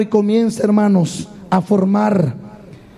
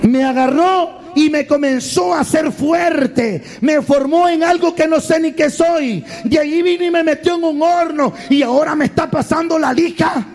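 high-pitched wailing complaint of the clay; hammed-up aggrieved high cry; acted screaming; 6 semitones higher than usual. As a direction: cry out in pained protest at the potter. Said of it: "comienza a gritar".

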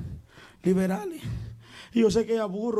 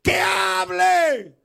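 high, loud, drawn-out shout; crowd shout; 10 semitones higher, 6 dB louder, much slower than usual. Retold or imitated. Congregation answering the preacher, loud slow shout. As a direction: yell it slowly as the whole crowd. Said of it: "todos gritaron".

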